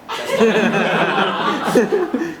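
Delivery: slow, loud, stretched protest through laughter; laughing denial. Real, not acted. To old friends teasing him, a slow, laughing denial. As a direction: laughs it off, dragging each word. Denies the crying policeman story.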